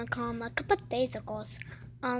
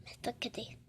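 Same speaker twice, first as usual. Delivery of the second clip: whisper; 10 dB quieter than usual. Real, not acted. Whispered aside.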